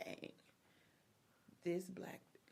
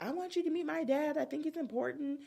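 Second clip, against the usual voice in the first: put-on a higher earnest girlfriend; lightly voiced girlfriend; 9 semitones higher than usual. Girlfriend asking fiancé to meet her dad, lightly voiced. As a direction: plead it sweetly high. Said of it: "she's like".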